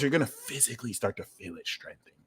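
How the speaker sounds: soft ASMR murmur, close and quiet. Real, not acted. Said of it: "A S M R voice".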